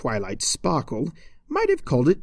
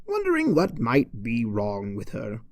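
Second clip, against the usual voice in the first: usual speaking voice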